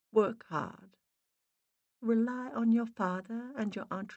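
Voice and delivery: faint, muffled Boston mother; muffled Boston spirit voice; Boston accent. A narrator's reading. Dead mother greets Miranda in a faint Boston accent. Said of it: "Boston accent, faint and muffled".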